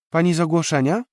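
put-on a kid peeking out, asking; small boy. (mały chłopiec, dużo wyżej, zaciekawiony)

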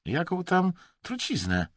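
high, brushing-off question; dismissive. A narrator's reading. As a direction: wave the worry away. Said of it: "mruknął z lekceważeniem Emerson". (lekceważąco, od niechcenia)

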